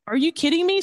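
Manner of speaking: a high, startled mock panic at the news; retold alarm. Telling the host how he reacted to the general's warning, stunned and high. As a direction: pitch jumps way up in stunned disbelief. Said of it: "I was like".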